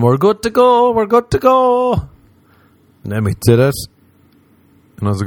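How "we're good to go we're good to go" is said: high, loud, rising enthusiasm; excited gaming boast. Acted. Would-be kart racer telling himself he's ready, excited. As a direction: pitch it up, cocky and thrilled.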